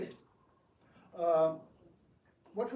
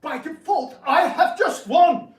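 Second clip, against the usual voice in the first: gloating shout; 5 semitones higher, 14 dB louder than usual. Acted. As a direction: crow over the win. Gloating claim of victory.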